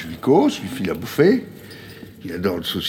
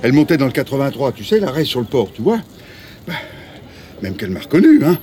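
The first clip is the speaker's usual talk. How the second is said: worked-up, raised voice, starting high; agitated; 4 semitones higher, 4 dB louder than usual. Acted. Rico insists he saw Léa, agitated outburst. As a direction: blurt it out, too wound up to calm down.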